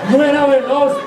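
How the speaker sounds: high-pitched, hammed-up tearful complaint; whiny wailing. Shaken envoy complaining to his king, whiny wailing. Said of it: "el enviado no hacía más que chillar".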